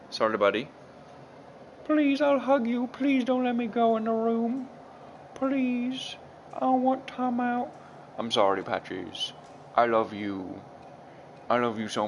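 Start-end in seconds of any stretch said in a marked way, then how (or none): 1.8-7.7 high, squeaky begging kitty voice; much higher voice, pleading cat. Pretend. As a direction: beg like a cat about to be shut in a room. Owner voices cat being shut away.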